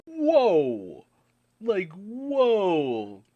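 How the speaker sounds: loud, high gasp of surprise; a real jaw-drop whoa; shocked whoa. Real, not acted. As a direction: blurt it out, stunned. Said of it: "And I'm like".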